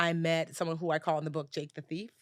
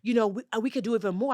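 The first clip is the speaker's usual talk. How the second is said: higher, smooth wheedling voice; mocking the scammer; 5 semitones higher than usual. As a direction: coax her like a slick con man. Mocking scammer asking for more.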